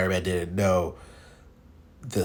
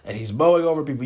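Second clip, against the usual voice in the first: normal talking voice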